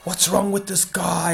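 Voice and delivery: high-pitched imitation of outraged complaining; high, whiny-crowd mock yell; mock shouting. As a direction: act out passengers yelling, without real volume. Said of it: "like, shouting and be like".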